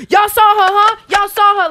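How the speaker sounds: high, loud begging for witnesses; pleading man. Retold or imitated. Man trying to get them as witnesses, high and loud. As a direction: push them high and loud to back him.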